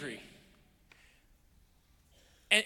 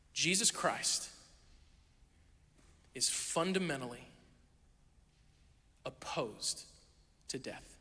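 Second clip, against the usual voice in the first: slow, much softer and lower, each word apart; solemn whispered emphasis; hushed conviction; 4 semitones lower, 6 dB quieter, slower than usual. Real, not acted. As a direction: let it drop to near silence. Sermon climax, hushed conviction.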